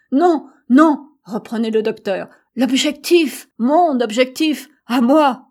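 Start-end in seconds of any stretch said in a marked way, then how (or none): 0.1-1.1 high, loud urgent protest; emphatic denial. A narrator's reading. Doctor urgently contradicts his companion. 2.5-5.4 loud, higher-pitched urgent cries; excited exclamation. A narrator's reading. Doctor excitedly reveals his discovery.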